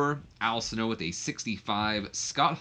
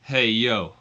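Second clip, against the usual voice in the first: loud drawn-out wrestler greeting; wrestling promo catchphrase, louder; Scott Hall; 7 dB louder than usual. Acted. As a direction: call it out like a wrestler on the mic. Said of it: "Scott Hall starts by saying".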